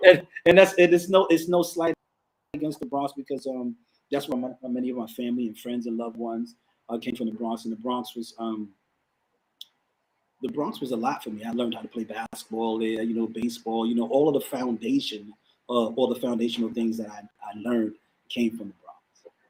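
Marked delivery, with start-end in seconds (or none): none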